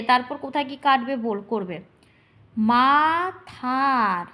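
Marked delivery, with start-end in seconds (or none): 2.6-4.3 very slow, long held vowels; broken into syllables. Shown as a demonstration. Teacher breaking verse before counting, slow split syllables. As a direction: stretch and split the word. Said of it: "প্রথমে ভেঙে নেবে".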